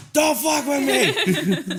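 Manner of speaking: high, loud comic warning; mock outburst. Acting out banter between friends, mock outburst.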